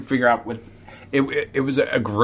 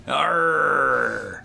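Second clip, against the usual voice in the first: pirate growl; 3 semitones higher than usual. Pretend. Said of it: "Said the pirate".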